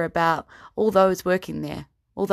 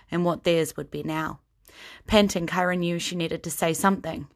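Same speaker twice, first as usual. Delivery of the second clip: ordinary talk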